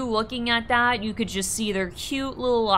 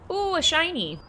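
ordinary talk

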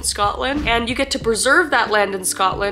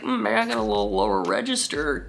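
lower register; 3 dB quieter than usual. Shown as a demonstration. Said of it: "'cause I sound like a man".

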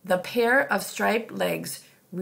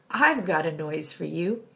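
usual speaking voice

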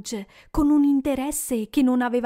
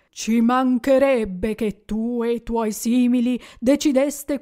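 rebuking ghost; 4 dB louder than usual. Acted. Ghost rebukes Scrooge for his cruelty, louder and grave. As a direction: scold him like a judge from above.

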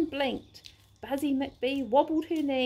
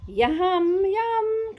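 greedy yum; 5 semitones higher, 6 dB louder than usual. Acted. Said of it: "Crocodile smiled".

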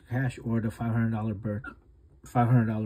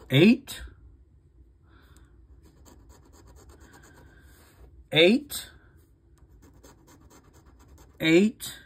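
excited; 5 semitones higher, 5 dB louder, much slower than usual. Real, not acted. Matching numbers on scratch ticket.

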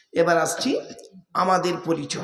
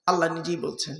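ordinary talk

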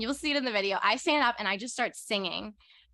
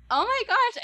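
a much higher, louder squeal of disbelief; starstruck gasp; 13 semitones higher, 6 dB louder than usual. Retold or imitated. A starstruck fan answering the singer's praise, squealing.

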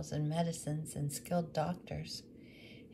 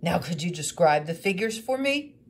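put-on louder, businesslike old man; gruff woodcarver; 10 dB louder than usual. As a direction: ask briskly to get on with it. Woodcarver asking for details.

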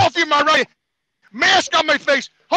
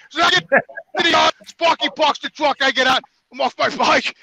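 usual speaking voice

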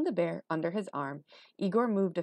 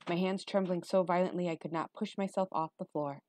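ordinary delivery, nothing marked

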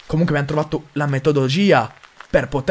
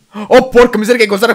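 startled cry; 7 semitones higher, 7 dB louder, faster than usual. Real, not acted. Scared by a creepy smile.

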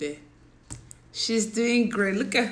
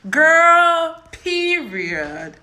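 put-on very loud, high girl, then a dropped period; sassy mock of a viewer's slang; sassy catchphrase; 7 semitones higher, 10 dB louder, much slower than usual. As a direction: belt girl then land period flat.

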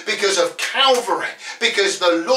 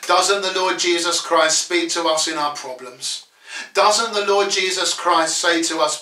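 normal talking voice

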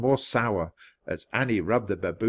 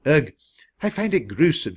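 higher-pitched distaste; shuddering disgust; 5 semitones higher, 4 dB louder than usual. A narrator's reading. Lady Bickerson reacts to jackal gods, shuddering disgust. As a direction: recoil a little from the idea. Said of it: "Lady Bickerson shuddered".

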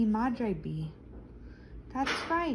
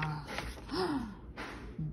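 dismayed gasp; 9 dB quieter than usual. Acted. Sees the long line.

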